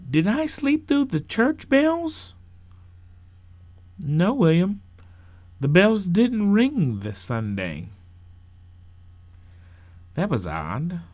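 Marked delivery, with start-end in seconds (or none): 0.1-2.4 put-on high, slow questioning child voice; drawn-out, high-pitched question; newly woken child. Woken boy answers parent. 4.0-7.9 raised parental voice, slowing down; high, deliberate reply; parent answering child. Acted.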